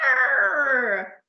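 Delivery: long, loud, high "arr"; pirate roar. Acted. Captain woken by cannon fire, long loud roar. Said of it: "roared Captain Abdul".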